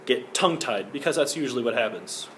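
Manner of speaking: usual speaking voice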